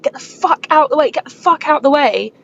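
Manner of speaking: high, urgent shout, slightly restrained; retold panicked yell. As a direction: re-enact yelling to clear the road. Retold panicked yelling while beeping.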